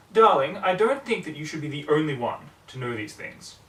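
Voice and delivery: a grand, airy Darling; Rarity's voice. Acted. Rarity quoted, higher and louder.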